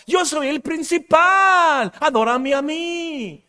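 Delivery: slow, high, shouted proclamation; solemn divine command, shouted; voice of God. Retold or imitated. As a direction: declare it as God claiming first place. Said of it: "dice Dios".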